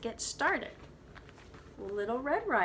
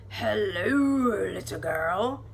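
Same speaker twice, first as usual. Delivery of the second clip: a hint of a growly wolf greeting; lightly deep growly wolf; 6 dB louder than usual. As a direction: play the wolf sweetly sly, only lightly growled. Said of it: "said a deep, growly voice".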